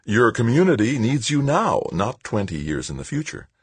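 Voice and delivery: put-on high-pitched, talking down to a small child; patronizing. A narrator's reading. Bureaucrat to Tavalera as if to a kindergarten pupil, patronizing. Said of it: "as if he were a kindergarten pupil".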